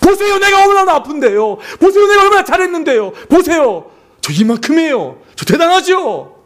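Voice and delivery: high, loud whiny boasting; childish showing off. Retold or imitated. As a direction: beg God to look like a needy child. Childish plea to God. (어린아이처럼 높고 크게 자랑하며 조르듯)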